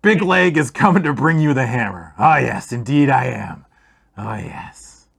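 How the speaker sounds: a dwarf boasting about his hammer; boastful dwarf. Acted. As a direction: crow it like a hero in a fever.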